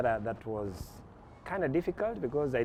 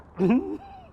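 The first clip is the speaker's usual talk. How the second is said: laughing; 7 dB louder than usual. Real, not acted. Laughs at having a manager.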